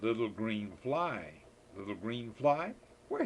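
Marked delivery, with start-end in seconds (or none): none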